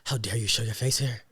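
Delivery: low, soft, dramatic accusation; wounded teacher, pausing heavily; acted hurt reproach. Teacher blaming student for making her cry, acted hurt reproach. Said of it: "I'm about to get in my acting bag".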